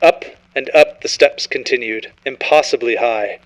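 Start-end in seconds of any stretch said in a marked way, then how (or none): none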